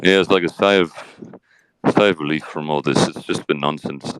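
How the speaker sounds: a low, laid-back Johnny Depp answering; Johnny Depp impression. Host interviews Johnny Depp on his case, lightly voiced low. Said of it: "stand up, Johnny Depp".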